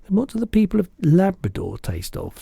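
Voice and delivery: put-on a curious little girl protesting; Sophie's voice. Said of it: "Sophie asked".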